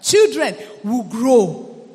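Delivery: loud, slow, emphatic preaching; preaching at full force. Acted. Preacher building up to his congregation, loud and emphatic. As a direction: hammer each virtue home to the church.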